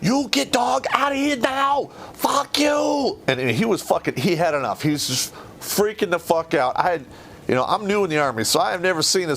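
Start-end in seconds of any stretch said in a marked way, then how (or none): 0.0-3.2 much higher, shouted broken English; furious broken-English sergeant major; mock broken-English yell. Sergeant major going nuclear.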